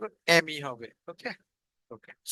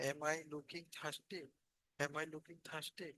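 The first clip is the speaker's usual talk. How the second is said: much softer, slow, dry-throated asking; parched and weak; 19 dB quieter, much slower than usual. Pretend. Teacher acting thirsty for students, softer and slow. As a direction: ask it like your throat is parched, slowly. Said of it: "গলা আমার শুকিয়ে গিয়েছে".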